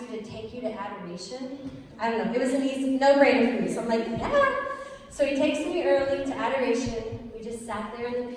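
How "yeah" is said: a high, loud, eager yeah. Retold or imitated. She eagerly accepts her boyfriend's invitation to adoration with a high, loud yeah. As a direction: jump at the invite.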